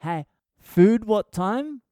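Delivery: high, slow, carefully separated words; simplified foreigner-friendly speech. Shown as a demonstration. Speaker modifies his speech so people understand, slow simplified talk. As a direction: spell it out for someone who barely follows. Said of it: "I don't speak like this during the day".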